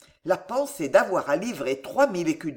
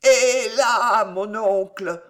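very slow slurred threat; higher, labored tipsy warning; drunk Gillot; 4 semitones higher, 5 dB louder, much slower than usual. Acted. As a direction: drag each syllable out drunkenly. Drunk nephew threatens uncle.

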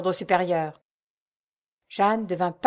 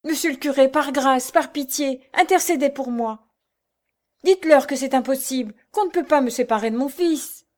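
higher, louder begging; beseeching plea; 5 semitones higher, 4 dB louder than usual. A narrator's reading. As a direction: implore the priest. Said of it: "mains suppliantes". (supplication ardente)

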